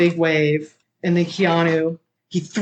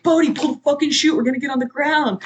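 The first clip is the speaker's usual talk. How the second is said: shouting; 9 semitones higher, faster than usual. Retold or imitated. Johnny yelling mid-freefall at Bodhi.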